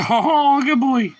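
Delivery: high, gushing praise with a laugh; delighted praise. Real, not acted. Owner praising the dog at the chair, high and delighted.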